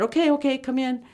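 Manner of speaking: high, eager come in; a boy calling the guests inside; high welcoming boy. Retold or imitated. Kid invites them inside.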